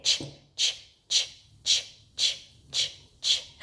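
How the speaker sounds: repeated unvoiced "ch" puffs; train chugging noise. Shown as a demonstration. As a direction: chug along like a train on "ch". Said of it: "Can we make the train make that ch".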